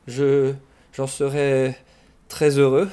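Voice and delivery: halting, with pauses between fragments; stammering. A narrator's reading. Berlioz caught out by his guest, stammering. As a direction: stumble into a polite answer. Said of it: "balbutia Berlioz".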